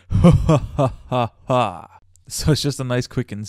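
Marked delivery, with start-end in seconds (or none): 0.1-1.9 mock evil laugh. Raw evil laugh for the effect.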